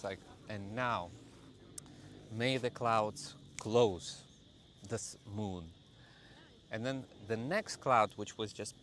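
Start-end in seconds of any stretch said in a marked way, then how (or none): 0.5-5.6 serious incantation. Retold or imitated. Commanding the clouds to cover the moon, serious incantation. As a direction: order the clouds with a straight face. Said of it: "I keep the serious face".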